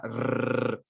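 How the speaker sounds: Rrr as a long trilled r; rolled r held. Shown as a demonstration. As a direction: roll the Rrr and hold it. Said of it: "la R fuerte se puede alargar".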